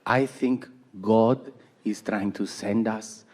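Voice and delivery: low, slow Peruvian mom, ominous; mother's Peruvian accent. Retold or imitated. Mom saying God sends a message, slow Peruvian accent. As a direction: imitate a Peruvian mother's portentous English, word by word. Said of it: "she's from South America".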